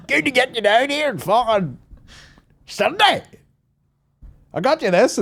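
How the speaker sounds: high, hollered stage patter; mock tour greeting. Comic handing gifts to fans on tour, high mock holler. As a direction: call out high to a gig crowd. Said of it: "if I ever do an Australian tour".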